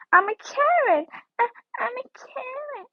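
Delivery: high-pitched playful outburst; mock exclaiming. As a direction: exclaim it high, as a silly announcement. Mock outburst on spotting Karen clothes.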